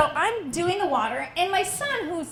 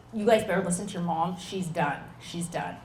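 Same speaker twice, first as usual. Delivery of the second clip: lower, fast stern dad; lightly voiced dad; 7 semitones lower than usual. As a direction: warn the kids like a dad. Dad on speakerphone warning kids.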